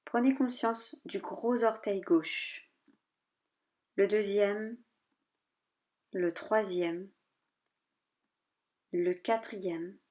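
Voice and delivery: measured body-scan list, a pause after each part; name each body part evenly, leaving space; calm yoga nidra guidance, short spaced phrases; guided meditation. Real, not acted.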